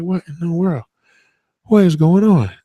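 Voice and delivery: high and loud, rising to a bewildered outburst; confused dad exclaiming at his toddler's tantrum; baffled. Retold or imitated. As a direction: throw up your hands in confusion. Son cries more, dad baffled.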